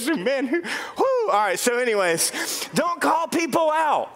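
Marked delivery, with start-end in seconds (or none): none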